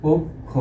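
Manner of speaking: পক্ষ split into "pok... kho"; syllable-by-syllable pronunciation. Shown as a demonstration. Teacher dictates the correct form, পক্ষ as "pok... kho". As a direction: say পক্ষ in two beats with closed o. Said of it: "তাহলে এটাকে আমরা বলবো".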